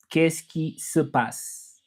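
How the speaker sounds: "qu'est-ce qui se passe" drawn out slowly, each syllable separate; slow French demo. Shown as a demonstration. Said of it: "So I can say ça va in French".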